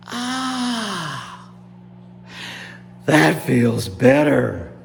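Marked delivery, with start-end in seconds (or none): 0.1-1.5 long, high falling "ah"; relieved sigh. Acted. Gators cooling burns in swamp, long relieved ah.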